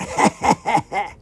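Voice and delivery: character chuckle in a put-on voice; put-on iguana laugh. Iguana laughing at his own hint, put-on chuckle. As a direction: chuckle knowingly as a creature.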